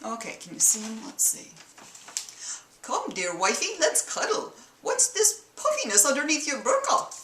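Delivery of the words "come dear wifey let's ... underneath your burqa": husband voice in a mock Middle Eastern accent, pitched higher; bad Middle Eastern accent, coaxing husband; put-on Middle Eastern accent. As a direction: play a cartoon husband with an amateur Mid-Eastern accent. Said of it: "excuse the accent".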